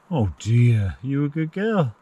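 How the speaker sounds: ordinary delivery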